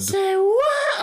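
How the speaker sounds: very high, squeaky "say what"; high-pitched attempt. Shown as a demonstration. Co-host trying the catchphrase for the host, straining high. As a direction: strain up into falsetto. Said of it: "I can't make my voice go that high".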